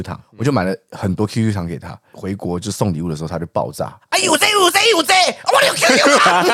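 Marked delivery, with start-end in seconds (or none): 4.1-6.5 high, loud flustered mother voice; agitated mom imitation. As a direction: play the worked-up mom scolding her son. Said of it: "我刚才模仿我妈".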